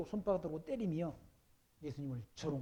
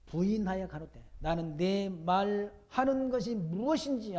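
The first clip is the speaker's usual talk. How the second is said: loud, higher, slow preached reading; scripture reading; 4 semitones higher, 8 dB louder than usual. Acted. Pastor reads Peter's denial to the congregation, proclaiming loudly. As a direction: proclaim the verses to the whole hall.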